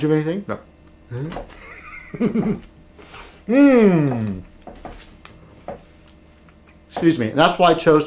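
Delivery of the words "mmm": drawn-out, falling "mmm"; savouring hum. Acted. Savouring more pie on camera, long falling hum. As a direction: hum with relish, sliding down.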